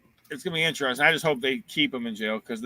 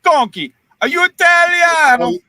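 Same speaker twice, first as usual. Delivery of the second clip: much higher, louder, slow hammed Italian; mock Italian accent; 12 dB louder, slower than usual. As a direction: sing it out like a cartoon Italian. Teasing about being Italian.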